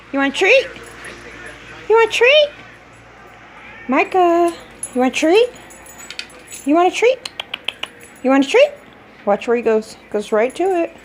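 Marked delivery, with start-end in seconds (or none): 0.1-8.7 excited dog talk. Real, not acted. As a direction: tease the dog with a treat. Offering dog a treat.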